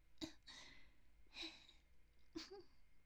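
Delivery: soft whispered giggle, a little sheepish; breathy embarrassed laugh. Acted. Intruder laughing off her outburst, soft whispered giggle.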